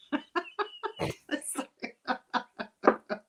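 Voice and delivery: big laugh ending in a snort. Real, not acted. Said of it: "Did you just snort?".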